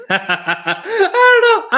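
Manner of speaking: laughing Midwestern "don't you know"; playful Midwestern sign-off breaking into a laugh; Midwestern accent. Retold or imitated. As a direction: tag it with a giggly Midwestern lilt. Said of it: "one of my favorite accents to do".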